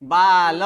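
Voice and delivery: बाल as baa-la, loud, the final a fully voiced; full final syllable. Shown as a demonstration. Teacher correcting students' reading, full final vowel. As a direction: give the l in बाल its whole vowel. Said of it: "क्योंकि ये ल संपूर्ण है".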